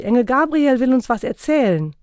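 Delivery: put-on high, loud children's announcement; children shouting. A narrator's reading. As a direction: call the parents over like a child. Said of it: "schrien sie".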